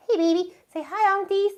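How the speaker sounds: high, coaxing pet voice. Real, not acted. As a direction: coo at the dog to greet viewers. Mother talking to her dog.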